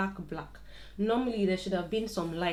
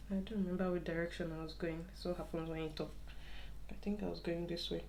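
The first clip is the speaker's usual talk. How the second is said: talking to oneself; 11 dB quieter, faster than usual. Real, not acted. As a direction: think aloud quietly while finding the thread. Lost thread, talking to herself.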